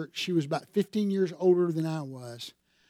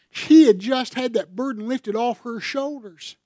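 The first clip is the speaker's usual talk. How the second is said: higher, louder preaching voice; preaching at full force; 6 semitones higher, 6 dB louder than usual. Acted. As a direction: urge them on with full conviction. Preacher urges listeners to confess aloud.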